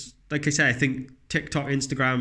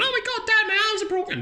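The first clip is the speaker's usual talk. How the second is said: put-on much higher, whiny child voice; little girl whining. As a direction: squeak it out like a hurt little girl. Daughter voiced after the fall.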